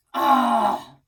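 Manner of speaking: loud fed-up groan of a word; exasperated ugh. A narrator's reading. Grandpa tired of the questions, loud exasperated ugh. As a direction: blurt it out, annoyed.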